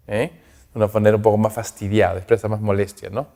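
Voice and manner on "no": ordinary talk, nothing marked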